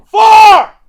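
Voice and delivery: full-volume high-pitched yell; loud, high golfer's holler; very loud warning shout. Retold or imitated. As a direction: bellow it across the golf course. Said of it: "literally this loud".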